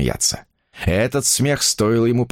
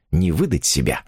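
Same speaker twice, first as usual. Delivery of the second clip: ordinary delivery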